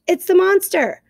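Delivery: high shrieking alarm cry; screech. Acted. Owl screeches a warning to the crowd, high and loud. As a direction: shriek it in panic. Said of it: "and screeched".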